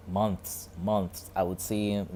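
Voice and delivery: ordinary talk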